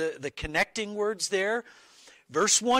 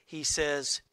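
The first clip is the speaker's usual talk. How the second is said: ordinary talk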